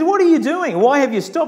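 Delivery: high, insistent questioning; exasperated challenge. Retold or imitated. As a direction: confront them in mock disbelief. Prophet challenges people who stopped.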